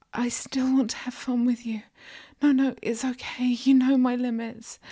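high, whispery voice; submissive reassurance. A narrator's reading. Submissive reassures mistress in dialogue.

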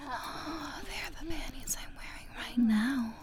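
soft intimate whisper; breathy ASMR whisper. Acted. Erotic roleplay whisper.

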